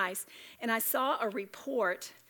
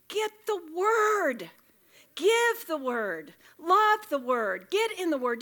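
loud, slow emphatic commands; preaching at full force; 7 semitones higher, 7 dB louder, slower than usual. Real, not acted. Urging the women to the word, loud. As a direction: drive each word home from the pulpit.

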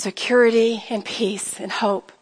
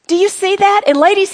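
ordinary talk

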